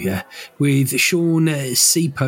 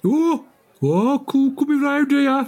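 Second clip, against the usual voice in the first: very high falsetto caricature; hammed-up, high-pitched character voice; comic put-on voice; 13 semitones higher than usual. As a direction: squeal it in a silly falsetto.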